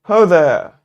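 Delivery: loud hail to distant strangers; calling out. Acted. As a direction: call out across the clearing. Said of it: "you call out".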